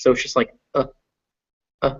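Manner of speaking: tiny weak uh; schwa demo. Shown as a demonstration. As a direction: barely voice the schwa. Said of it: "It barely exists".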